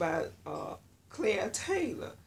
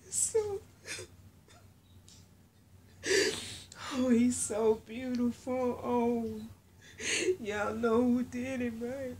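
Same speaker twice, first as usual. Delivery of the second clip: real happy crying, high voice; overcome, crying through the words in gratitude; tearful joy; 5 semitones higher than usual. Real, not acted. Thanking the Lord for her beautiful baby, crying. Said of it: "I'm trying not to cry".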